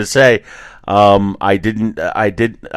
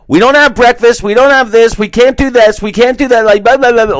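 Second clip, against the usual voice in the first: much higher and louder mock rant; imitating yelling; 6 dB louder than usual. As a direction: bark it out like an angry cashier. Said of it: "she's literally yelling at us".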